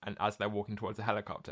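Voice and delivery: normal talking voice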